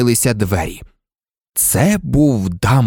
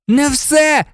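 put-on high, urgent boy's shout; sharp high-pitched objection; yelling. A narrator's reading. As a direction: cry out in frantic protest. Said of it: "зарепетував Гаррі".

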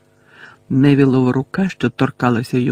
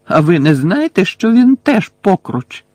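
louder, rising in pitch, a reckless challenge; desperate interruption; 6 semitones higher, 5 dB louder than usual. A narrator's reading. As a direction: cut in loudly and desperately. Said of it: "відчайдушно урвав її Гаррі".

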